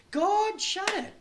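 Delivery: high, slow pulpit declaration; emphatic preaching. Real, not acted. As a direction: proclaim it slowly, word by word. Preaching at full force.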